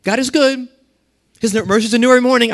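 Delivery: high, quick parroted phrase; sing-song parroted church phrase; parrot-like repeating. Retold or imitated. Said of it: "the parrots just repeat what everybody else says".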